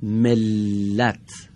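ملت drawn out very slowly, doubled ل held; slow gemination demo. Shown as a demonstration. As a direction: stretch ملت for pupils to hear the double l. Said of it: "کدام حرفش دارای تشدیده".

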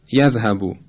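یذهبو with final short "u"; short closed "u" at the end of یذهبو; correct Arabic vowel. Shown as a demonstration. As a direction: model یذهبو as in Quran recitation, ending "bu". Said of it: "همینطور در تلاوت قرآن".

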